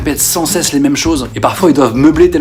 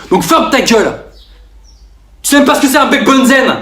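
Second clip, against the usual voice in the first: much higher, heated yelling; angry rant; 10 semitones higher than usual. Acted. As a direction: snap back like a know-it-all. Know-it-all shouting at critics. (râlerie énervée et aiguë)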